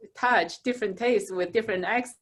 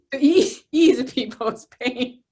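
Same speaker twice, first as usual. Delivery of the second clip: laughing through the words, louder and higher; 4 semitones higher, 6 dB louder, slower than usual. Real, not acted. Laughing while explaining how humor eases others. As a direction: let the laugh spill into the words. Laughs at her own point.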